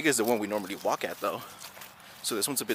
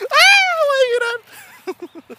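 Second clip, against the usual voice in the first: mock wail; 13 dB louder, much slower than usual. Mock crying over getting old.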